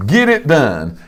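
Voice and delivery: ordinary talk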